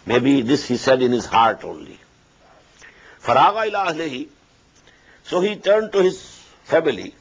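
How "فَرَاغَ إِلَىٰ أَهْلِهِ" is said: rapid Quranic recitation, higher pitch; quick recitation. Acted. Teacher reciting the verse quickly before translating.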